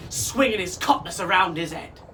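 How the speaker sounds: ordinary delivery, nothing marked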